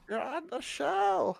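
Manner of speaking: very high, slow squeaky voice. Real, not acted. Owner telling his puppy she's on the show, baby talk.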